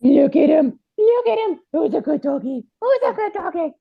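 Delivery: puppy voice. Acted. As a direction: coo at the new puppy. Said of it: "you guys have to stop talking in that puppy voice".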